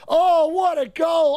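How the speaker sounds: high drawn-out goal shout; long goal yell. Real, not acted. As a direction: stretch it out in a joyful yell. Still celebrating the goal.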